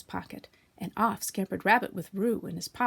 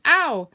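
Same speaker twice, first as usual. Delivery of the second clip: louder, higher yelp of pain; 7 semitones higher, 10 dB louder than usual. A narrator's reading. Piglet landing hard in the pocket, loud yelp. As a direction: yelp as you land. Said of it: "he said".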